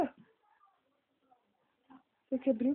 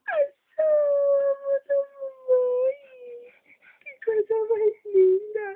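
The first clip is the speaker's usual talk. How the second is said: excited peekaboo; 10 semitones higher, 8 dB louder than usual. Acted. Peekaboo reveal to baby.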